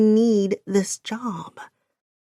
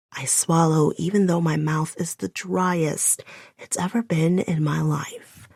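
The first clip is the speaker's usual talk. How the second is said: ordinary delivery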